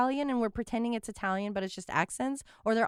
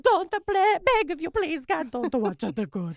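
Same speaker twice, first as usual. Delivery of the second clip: higher, louder hammed-up Italian accent begging; mock Italian plea; 7 semitones higher, 8 dB louder than usual. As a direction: beg in a cartoon Italian accent.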